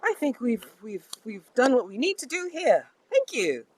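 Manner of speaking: high, loud, acted dismissal; loud, high-pitched mock politeness; pointed brush-off. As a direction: cut him off loudly and pointedly. Acted brush-off after dropping desk.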